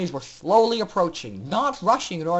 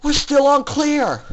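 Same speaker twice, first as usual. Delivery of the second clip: higher, loud and slow; shout; 8 semitones higher, 6 dB louder, slower than usual. A narrator's reading. Scootaloo rallying Aurora at the door, slow loud shout. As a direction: shout the order over the chaos. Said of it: "she shouted".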